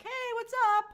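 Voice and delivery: high, bright mock hello from Danny; chirpy mock greeting; casual ghostly greeting. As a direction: pop in with a breezy high hi. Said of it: "be like".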